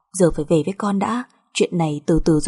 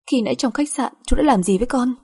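higher, indignant question; upset accusation; 5 semitones higher than usual. Acted. Wife confronting husband about the hotel, upset. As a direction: press the accusation with hurt.

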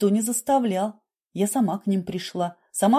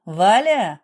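louder, drawn summons; calling out; 6 dB louder than usual. A narrator's reading. Doctor summoning the nurse from behind the door, calling out. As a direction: call for someone beyond the door. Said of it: "Крикнул Георгий Рубенович".

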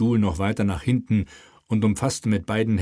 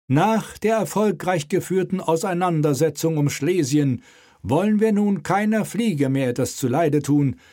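raised, pompous royal announcement; slightly pompous; 9 semitones higher, 3 dB louder than usual. Acted. King dictates his great moment to the scribe, pompous. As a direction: announce it for posterity. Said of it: "mit leicht pompöser Stimme".

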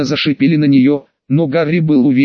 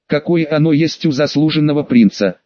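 ordinary delivery

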